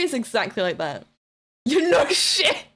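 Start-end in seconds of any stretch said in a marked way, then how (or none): 1.7-2.6 higher, louder, slow laughing jab; laughing retort. Real, not acted. Streamer to Hungry, laughing retort.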